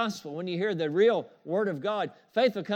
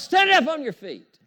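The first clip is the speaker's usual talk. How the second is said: high, loud order to stand; loud command; 9 semitones higher, 9 dB louder than usual. Retold or imitated. Paul commanding the crippled man to stand, loud shout. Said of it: "So Paul said with a loud voice".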